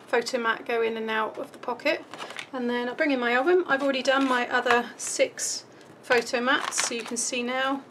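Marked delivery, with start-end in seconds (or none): none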